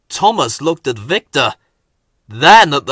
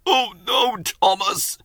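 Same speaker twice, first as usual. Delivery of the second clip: wheezy; 8 semitones higher than usual. A narrator's reading. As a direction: wheeze out each word. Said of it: "Henry wheezed".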